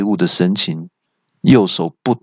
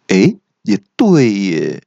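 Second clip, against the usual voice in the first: slow, drawn-out realization; 恍然大悟; 3 dB louder, slower than usual. A narrator's reading. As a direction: let it dawn on you slowly. 恶怪歪头想后恍然大悟. (拖长音，恍然大悟)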